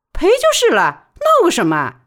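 high and loud, impatient; indignant. Acted. Baoli snapping at the thugs, high and indignant. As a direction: brush off the fuss sharply.

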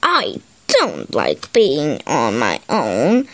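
put-on low, grumbly voice of a bored child; sulky, low-pitched grumble; grumbling. A narrator's reading. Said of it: "he grumbled".

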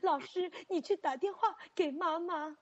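put-on childish plea. Child asking teacher.

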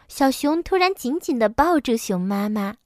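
ordinary delivery, nothing marked

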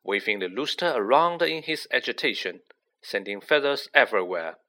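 ordinary delivery

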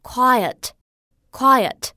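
slow careful two-syllable quiet. Shown as a demonstration. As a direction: say quiet slowly as qui-et, two beats. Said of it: "叫做安静的，quiet". (慢读quiet，两个音节)

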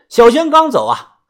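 ordinary delivery

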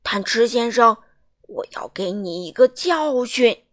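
very loud booming giant; giant shouting. A narrator's reading. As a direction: bellow it like a stern giant. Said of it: "他大叫道".

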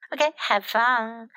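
plain reading voice

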